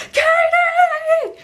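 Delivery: high, loud imitated wail; mock hysterical cry. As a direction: escalate into a hammed-up howl. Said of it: "freak out and cry together".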